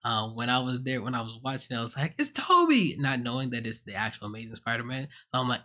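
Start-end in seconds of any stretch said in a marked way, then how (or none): 2.2-3.0 high, loud excited exclaim; excited recognition. Retold or imitated. Fan in the theater guessing the hero, excited high shout. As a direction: yell it out like a fan in the cinema. Cinema reaction, thinks it's Toby.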